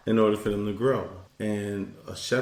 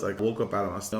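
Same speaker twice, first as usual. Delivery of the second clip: usual speaking voice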